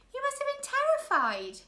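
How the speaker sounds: put-on a boy full of concern; worried boy. Klaus sympathizing with his sister, high and worried.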